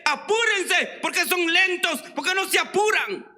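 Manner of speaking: irritated preacher shouting from his car window; angry yell. Retold or imitated. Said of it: "Y él enojado".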